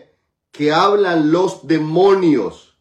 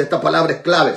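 ordinary delivery

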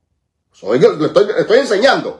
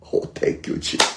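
normal talking voice

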